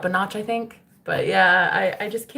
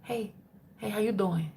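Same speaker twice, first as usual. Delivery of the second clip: whispering "hey, hey, remember me?"; whispered spirit voice; 2 semitones higher, 9 dB quieter than usual. Retold or imitated. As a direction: breathe it like a spirit in your ear. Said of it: "higher self's trying to get a hold of you".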